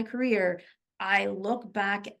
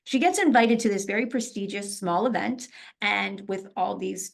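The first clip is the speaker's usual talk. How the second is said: ordinary talk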